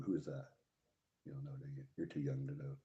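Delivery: usual speaking voice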